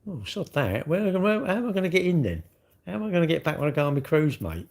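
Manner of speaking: high-pitched, put-on protest; mock dismay. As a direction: play at being worried, pitch jumping up. Mock worry about stopping boats.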